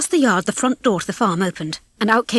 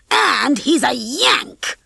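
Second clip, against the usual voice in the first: high, slow, indignant harumph; harumphing old rooster; 5 semitones higher, much slower than usual. A narrator's reading. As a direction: draw it out in disgust. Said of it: "Fowler harumphed".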